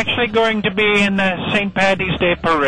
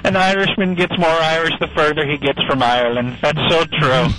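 broad comic Irish brogue; put-on Irish accent; 2 semitones lower than usual. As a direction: play a jokey stage Irishman with a brogue.

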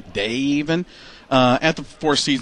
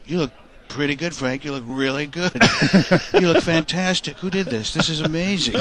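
Trump voice. Retold or imitated. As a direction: purr the compliments like Trump. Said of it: "He goes".

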